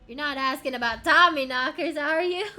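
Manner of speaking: very loud, rising incredulous question; hammed-up loud disbelief; incredulous outburst. Miner voiced, amused incredulous question.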